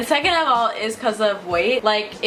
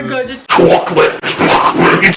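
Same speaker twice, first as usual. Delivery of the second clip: slow, loud drawn-out greeting; slow deep intro voice; 3 semitones lower, 10 dB louder, much slower than usual. Shown as a demonstration. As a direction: drag the intro out low and slow. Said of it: "That's how I'd say it if I had your channel".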